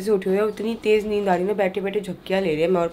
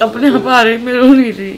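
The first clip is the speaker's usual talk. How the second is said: loud tearful insistence through real crying; tearful protest; 2 semitones higher, 11 dB louder than usual. Real, not acted. Wife insisting tears just come while husband begs her to stop, crying. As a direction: push the words out between sobs, louder. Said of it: "मत रो ना".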